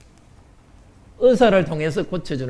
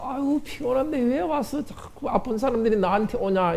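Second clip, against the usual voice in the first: mildly put-on weary complaint, higher and softer; annoyed grumbling; 7 semitones higher, 6 dB quieter than usual. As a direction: act out being bothered by visitors. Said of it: "귀찮아서". (귀찮은 듯 투덜대는 말투)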